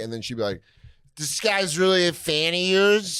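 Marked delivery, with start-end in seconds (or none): 1.2-3.2 high, slow drunk-heckler imitation; drunk lady. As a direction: drawl it high, mocking a drunk woman. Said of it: "this drunk lady walks up".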